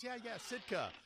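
squeaky high, loud bluff; high, loud panicky bluff, pretending to know; fake agreement. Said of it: "And she goes".

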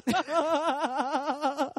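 very high, laughing cranky rant; angry comedian. Retold or imitated. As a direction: rant like a squeaky grumpy comic. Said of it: "that would be, like, your brother right there".

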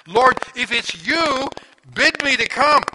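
high, slow pleading call from the boat; pleading Peter. Retold or imitated. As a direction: beg to come out on the water, high and drawn out. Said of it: "I wish that I had the guts that Peter had".

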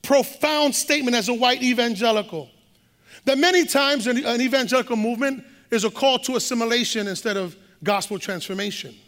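ordinary talk, nothing marked

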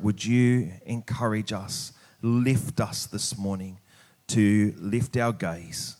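normal talking voice

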